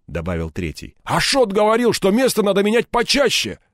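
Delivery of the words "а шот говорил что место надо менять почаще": high-pitched, urgent crook; pitched-up gangster urgency; agitated thug. A narrator's reading. Third crook urging gang to kill Egor, agitated. Thugs argue over the hideout.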